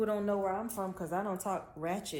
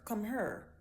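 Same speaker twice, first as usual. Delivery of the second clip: Memphis accent; 2 semitones higher than usual. Shown as a demonstration. Speaker imitating Memphis speakers for viewers. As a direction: Come her with here said as her. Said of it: "you might say her".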